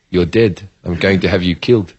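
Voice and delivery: low, quiet threat through clenched teeth; gritted-teeth threat. Retold or imitated. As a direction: menace hidden behind a forced smile. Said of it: "through gritted fucking teeth".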